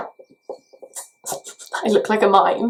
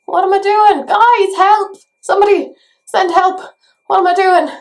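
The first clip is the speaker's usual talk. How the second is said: high, loud calls for help; mock panic; 9 semitones higher, 5 dB louder than usual. Vlogger begging her viewers for help, high mock panic. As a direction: cry out to the viewers in mock distress.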